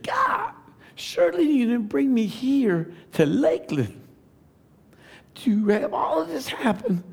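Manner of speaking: high, pleading cry to God; crying prayer. Retold or imitated. Father praying as his newborn comes out blue, pleading high cry. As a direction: beg God with rising anguish. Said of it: "I began to cry".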